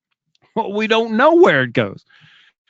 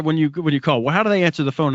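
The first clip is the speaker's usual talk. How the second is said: usual speaking voice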